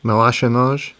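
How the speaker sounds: tries a French accent on the word; French accent attempt. Shown as a demonstration. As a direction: sound it out in a hammed-up French accent. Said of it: "I'm gonna put it out in a French accent".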